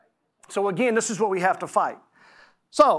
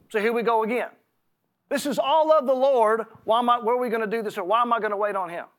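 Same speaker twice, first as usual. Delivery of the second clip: ordinary talk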